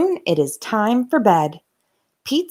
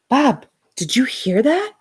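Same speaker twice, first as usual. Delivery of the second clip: frightened question; 5 semitones higher than usual. Acted. Frightened Pete whispering.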